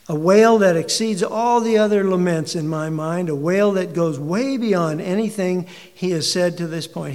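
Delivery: loud, rising preacher's call; preaching at full force. Acted. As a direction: drive the words out to the back pew. Preacher building in intensity.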